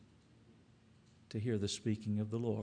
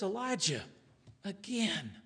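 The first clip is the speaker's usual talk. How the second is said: gentle voice of the Lord; 7 semitones higher than usual. Acted. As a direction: call him back gently. The Lord speaking gently to Elijah.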